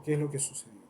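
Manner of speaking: ordinary delivery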